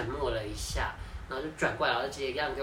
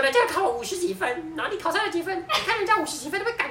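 high, louder mock scolding; scolding mother; 6 dB louder than usual. Neighbor's mom scolding her son, high mock scolding. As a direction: nag like a cross mom. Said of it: "他说".

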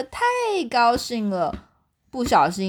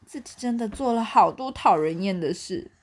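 ordinary delivery, nothing marked

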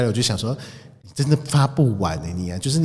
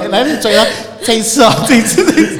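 much higher, louder playful drill-leader calls; excited coaching; 11 semitones higher, 9 dB louder than usual. Pretend. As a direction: cheer the kid on like an exercise coach. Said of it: "你就跟她讲说". (大声又兴奋地带操口令)

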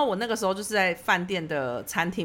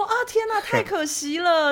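mock dismay; 10 semitones higher, slower than usual. Waitress gushing to owner's family.

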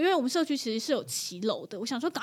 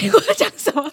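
much louder and higher, laughing through it; amused self-correction with a laugh; laughing at herself; 11 semitones higher, 10 dB louder than usual. Real, not acted. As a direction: laugh at your own slip.

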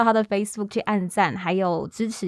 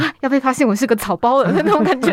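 giggly, louder and higher, poking fun at herself; laughing at herself; 5 semitones higher, 6 dB louder than usual. Real, not acted. Host laughing as she confesses her nerves to the guest. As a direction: laugh through a self-deprecating confession.

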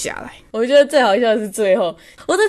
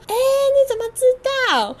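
much higher, amazed question; high astonished squeal; surprised tourist. Retold or imitated. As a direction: play the tourist caught off guard. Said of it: "然后就说".

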